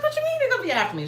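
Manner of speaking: same high rushed alarm; panicked question. Retold or imitated. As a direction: repeat the panicked squeak. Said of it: "I was like".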